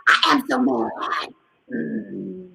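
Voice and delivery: slow, growly mock anger; mad demo. Shown as a demonstration. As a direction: act mad slowly for children to copy. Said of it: "You can practice right now".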